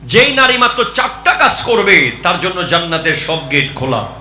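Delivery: high, loud proclamation settling at the end; forceful preaching. Real, not acted. Preacher promises women the gates of paradise, forceful preaching. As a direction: proclaim the promise at full voice, then come down.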